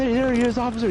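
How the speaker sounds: very high, gleeful mock voice; triumphant owner. Car owner calling police to the thief, high glee. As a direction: hand him over, gloating. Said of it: "He's like".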